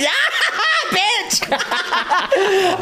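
high shrieking cackle through the words; hammed-up hysterical laughing outburst; inappropriate laughter. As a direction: burst out laughing at mock bad news. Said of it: "I will laugh".

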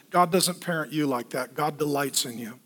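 ordinary talk, nothing marked

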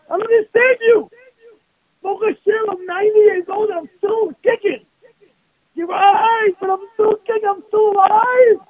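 high, loud, beaming defiance; cheerful old man. Retold or imitated. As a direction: crow it like a spry ninety-year-old.

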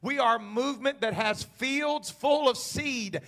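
loud, high, driving sermon cadence; full-force preaching. Acted. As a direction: preach it to the rafters.

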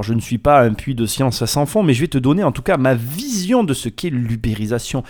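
usual speaking voice

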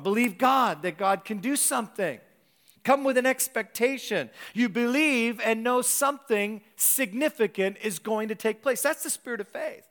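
loud, rising preacher's exhortation; preaching at full force. Acted. Pastor urging the congregation to come expecting, full preaching voice. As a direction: stir the church up, call out with fire.

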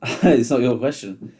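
laughing, slow teasing reply; chuckling, pointing out it wasn't his question; laughing retort. Real, not acted. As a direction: tease him through a laugh. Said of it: "thank you, thank you".

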